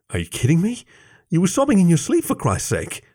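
high-pitched incredulous reproach; exasperated. A narrator's reading. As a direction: scold in disbelief, pitch climbing high.

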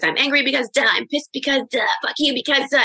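high, insistent venting without shouting; mock anger release. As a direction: imitate a coach listing grievances angrily. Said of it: "start hitting that pillow and screaming".

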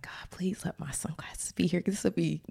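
whispered little prayer; whispering to God while hunting for sunglasses. Retold or imitated. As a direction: pray under your breath, pleading.

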